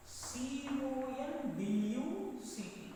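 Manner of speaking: slow letter-by-letter spelling. Real, not acted. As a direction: spell it out slowly as you write. Spelling a word while writing.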